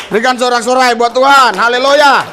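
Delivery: loud, rousing call to the crowd; loud preacher's rallying call. Real, not acted. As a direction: lift the room with a loud cry. Calling the crowd to cheer.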